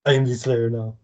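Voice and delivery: lightly voiced movie hijacker. Co-host echoes host's Slayer joke, Captain Phillips parody. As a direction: copy the hijacker's accent a little, mockingly. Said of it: "that actor".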